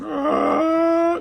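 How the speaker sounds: high, loud mock cry of release; imitated wail. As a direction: let out a sudden high howl of emotion. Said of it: "he let out this noise".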